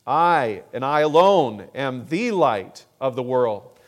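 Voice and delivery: "I" hit hard and loud, then "and I alone" just as strong; emphatic I. Shown as a demonstration. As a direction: lean on every "I" like it excludes all others. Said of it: "here is emphatic".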